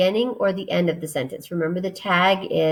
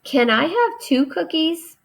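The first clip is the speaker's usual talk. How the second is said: put-on high, childlike begging voice; mock child asking for cookies; eager asking; 9 semitones higher than usual. Shown as a demonstration. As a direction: ask like a kid who wants cookies. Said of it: "I asked".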